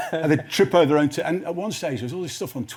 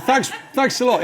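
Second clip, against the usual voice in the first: laughing through a sarcastic thanks, higher; mock-grateful thanks, laughing and higher; 11 semitones higher, 5 dB louder than usual. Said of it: "And I said".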